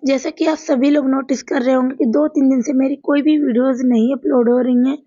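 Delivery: usual speaking voice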